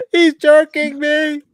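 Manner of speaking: high, loud, drawn-out falsetto cry; hammed-up slow victim's wail; mock horror victim. Joke as the victim in dark.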